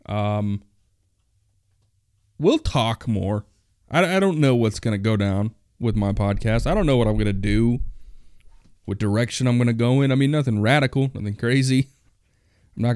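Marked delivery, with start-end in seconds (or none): none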